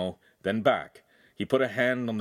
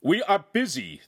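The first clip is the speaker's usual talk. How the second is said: high, louder irritated snap; annoyed raised shout; angry shout; 10 semitones higher, 4 dB louder than usual. Acted. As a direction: bark it at the door. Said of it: "the podesta shouted angrily".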